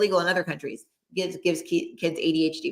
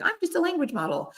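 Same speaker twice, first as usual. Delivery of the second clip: much higher mock chatbot line; robotic AI voice; 11 semitones higher, 3 dB quieter than usual. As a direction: parody a canned AI disclaimer. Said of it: "AI is always like".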